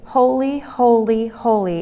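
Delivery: "holy, holy, holy" as separate weighted beats, stepping down in pitch; repetitive emphasis. Shown as a demonstration. Stretching the listeners' imagination, repetitive emphasis on "holy". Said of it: "my repetitive emphasis".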